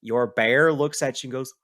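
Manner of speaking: normal talking voice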